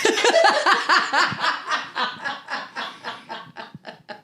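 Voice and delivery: long giggling burst; high-pitched laugh. Real, not acted. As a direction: crack up high. Big laugh at joke.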